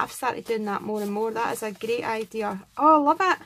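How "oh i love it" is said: much louder, higher burst of love for it; delighted exclamation. Real, not acted. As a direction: gush with real delight. Exclaims over gifted card.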